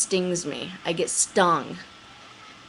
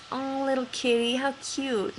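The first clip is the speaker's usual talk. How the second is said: cooing; 8 semitones higher than usual. Retold or imitated. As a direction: coo at the little kitty. Cooing at a cat.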